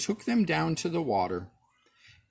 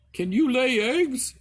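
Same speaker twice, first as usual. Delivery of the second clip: higher, louder fussy hen voice; hen asking; 10 semitones higher, 6 dB louder than usual. A narrator's reading. Hen quizzing the duckling, high and loud. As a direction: cluck the question at him. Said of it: "asked the hen".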